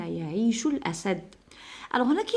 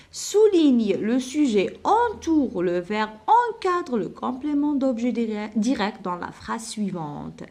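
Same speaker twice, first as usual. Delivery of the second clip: slow dictation; much slower than usual. Shown as a demonstration. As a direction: spell out the instruction slowly for copying. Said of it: "dictating the exercise instruction".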